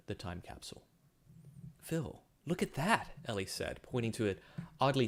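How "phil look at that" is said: higher, slow and amazed; excited. Acted. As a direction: point it out in wonder. Spotting something amazing.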